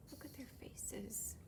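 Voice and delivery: soft unvoiced whisper; hushed aside. Real, not acted. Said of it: "aside to children".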